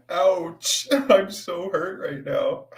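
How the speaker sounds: high laughing mock pain; laughing sarcasm. Real, not acted. Streamer mocking a viewer's jab while laughing. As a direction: laugh while faking hurt.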